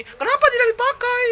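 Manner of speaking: high squeaky commenter voice; imitating a commenter. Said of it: "komentar pasti".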